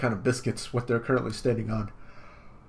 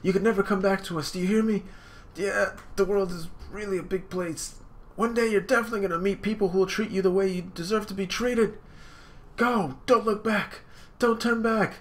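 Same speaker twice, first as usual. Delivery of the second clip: stern sister; 10 semitones higher than usual. Acted. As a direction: urge him away, high and firm. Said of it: "Sister's like".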